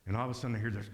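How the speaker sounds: usual speaking voice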